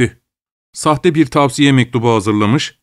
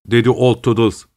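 ordinary delivery, nothing marked

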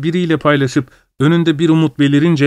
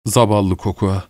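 "Zavallı Kokua" said lower and softer, tenderly; comforting his wife, he says "Zavallı Kokua" softly and gently; 4 semitones lower, 4 dB quieter than usual. A narrator's reading. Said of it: "dedi tekrar". ("Zavallı Kokua" alçak ve yumuşak, şefkatle)

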